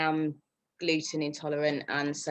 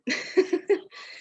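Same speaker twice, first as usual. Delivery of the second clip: a bright breathy laugh; high laugh; 13 semitones higher than usual. Real, not acted. Telling the team how she went for it, laughing. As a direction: laugh with relief. Laughing after "All right, I will.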